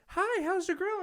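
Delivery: very high, sugary-sweet imitation; chirpy mock greeting. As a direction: squeak out a friendly hello. Mimicking a very friendly greeting.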